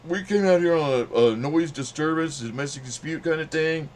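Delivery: retold cop laying down the facts, loud. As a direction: bark it like a cop on a call. Cop explains call, speaker voices him.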